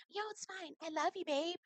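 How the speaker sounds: high, soft mock sweetness; tiny high-pitched reassurance; dainty girlfriend voice. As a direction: imitate a falsely sweet girlfriend.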